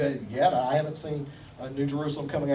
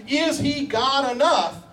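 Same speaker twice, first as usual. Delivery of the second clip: preaching at full force; 7 semitones higher than usual. Acted. Preacher calling the church, full force preaching. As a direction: preach it out to the congregation.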